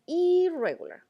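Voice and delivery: "irregular" stressed hard on the opening "i". Shown as a demonstration. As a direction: punch and stretch the start of "irregular". Flags the exception among regular verbs.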